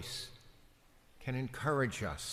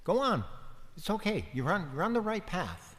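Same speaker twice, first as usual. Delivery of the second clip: high, gentle encouraging voice of the Lord; 7 semitones higher, faster than usual. Retold or imitated. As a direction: lift the voice up kindly, as the Lord reassuring. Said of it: "the Lord said".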